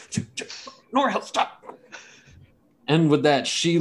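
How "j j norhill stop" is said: flustered. Retold or imitated. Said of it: "You're like".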